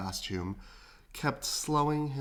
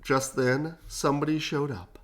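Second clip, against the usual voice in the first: ordinary delivery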